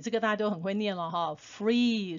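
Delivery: ordinary delivery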